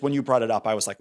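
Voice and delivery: ordinary talk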